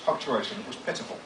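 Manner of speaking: ordinary delivery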